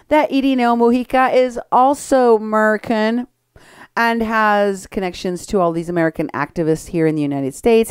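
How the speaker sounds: usual speaking voice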